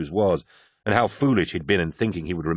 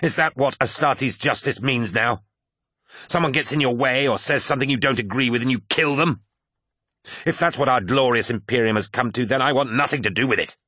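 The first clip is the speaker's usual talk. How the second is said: angry tirade; 5 semitones higher than usual. Acted. Carcasey rails at Loken over the massacre, higher and accusing. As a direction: hurl the accusation at him.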